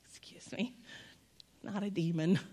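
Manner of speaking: hushed, low polite butting-in; soft, whispered aside; discreet interjection. Retold or imitated. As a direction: lean in and quietly correct a stranger. Acts timid interjection to strangers on plane.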